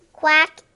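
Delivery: loud bright quack; duck quack. Pretend. Duck quacking in picture book.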